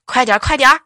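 loud, urgent calls; shouting from behind. Retold or imitated. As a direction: keep hollering after the rider. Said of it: "我哥还在后面喊". (在后面接着喊)

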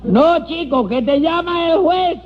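high, exasperated holler; high insistent call; shouting to the deaf. Acted. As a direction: correct a deaf man at the top of your voice. Repeating for a deaf man.

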